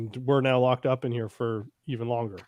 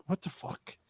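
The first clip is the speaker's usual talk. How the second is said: whispered; 13 dB quieter than usual. Retold or imitated. Retold reaction of officials.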